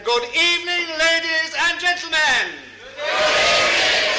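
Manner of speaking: booming high greeting called out to the hall; hammed-up welcome shout; big welcoming shout. Shown as a demonstration. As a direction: greet the crowd at full volume, high and loud. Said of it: "can we have it again?".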